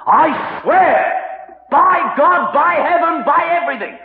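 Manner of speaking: high, shouted vow; frantic, emphatic swearing; swearing an oath. Acted. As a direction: hammer out each oath at full pitch. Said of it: "With oaths".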